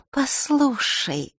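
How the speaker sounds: slow, coaxing, ingratiating call. Acted. A girl coaxing the boy, ingratiating. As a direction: draw him in sweetly. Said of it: "вкратчиво начала Маришка".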